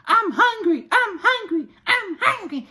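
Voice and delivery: put-on very high, loud kid's whine; whiny child voice. Mom imitating her kids whining at McDonald's, very high and whiny. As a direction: beg like a little kid in McDonald's. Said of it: "And they in McDonald's".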